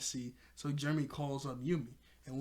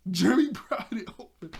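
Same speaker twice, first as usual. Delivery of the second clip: loud, high, laughing disbelief; 6 semitones higher, 8 dB louder than usual. Real, not acted. Host marvelling at Jeremy's strength, loud laughter. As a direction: laugh out the name in amazement.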